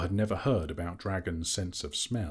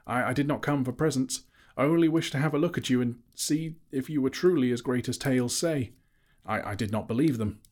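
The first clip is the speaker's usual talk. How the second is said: higher stammering hobbit voice; nervous Bilbo; 6 semitones higher, 4 dB louder than usual. A narrator's reading. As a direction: stammer up to the dragon. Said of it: "he replied".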